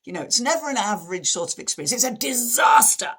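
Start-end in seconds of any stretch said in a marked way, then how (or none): 1.9-3.1 high, loud mock catastrophe; hammed-up, over-the-top dismay; really dramatic friend. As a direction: wail it like a drama queen. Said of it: "Really dramatic".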